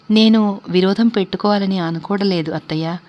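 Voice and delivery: plain reading voice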